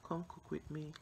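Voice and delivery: normal talking voice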